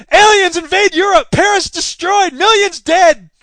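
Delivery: loud, high, alarmed proclamation; shouted headline. Acted. Front page of the morning paper, shouted headline. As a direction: punch out each headline like breaking catastrophe. Newspaper headline read aloud in story.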